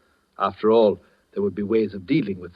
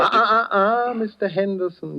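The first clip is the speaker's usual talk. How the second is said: smug interruption; 10 semitones higher than usual. Acted. Edwards cuts in smugly.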